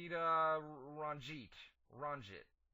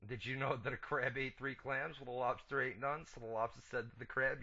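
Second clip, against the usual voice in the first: usual speaking voice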